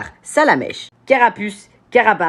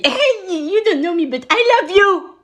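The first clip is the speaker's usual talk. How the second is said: high, excited fan outburst; gushing fan; 11 semitones higher than usual. Pretend. Him meeting TikTokers he admires, high gushing greeting. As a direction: blurt it out high, like a starstruck fan.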